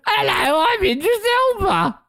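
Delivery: very high, squeaky mock impression; badly faked celebrity voice, far above your own; Idris Elba. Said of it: "gonna work on impressions".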